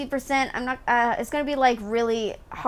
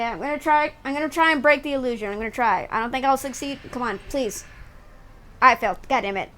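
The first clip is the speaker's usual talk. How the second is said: ordinary talk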